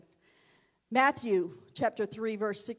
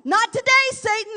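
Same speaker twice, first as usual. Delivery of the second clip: much higher, louder defiant yell; 11 semitones higher, 14 dB louder, faster than usual. Shown as a demonstration. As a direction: shout it at Satan. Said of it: "we need to yell".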